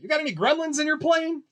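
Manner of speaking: put-on high, cheeky little kid; an innocent kid's question; young boy voice. Boy asking the grumpy pilot about gremlins, high.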